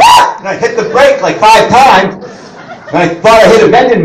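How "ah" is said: very high, sudden yelp; mock startled scream. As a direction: yelp in panic. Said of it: "So I went".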